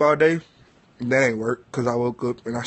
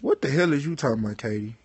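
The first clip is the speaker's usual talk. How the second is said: fast confused question; much faster than usual. Real, not acted.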